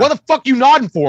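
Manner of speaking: loud, high mock confrontation; aggressive challenge. Angry fan confronting a nodding stranger, loud high challenge. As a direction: pick a fight over a nod.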